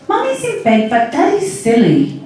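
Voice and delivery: put-on high-pitched little girl explaining; young child's voice. A narrator's reading. Little Chrissy explaining to Sally, young child's voice. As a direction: start very high, like a small child. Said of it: "the voice was really young".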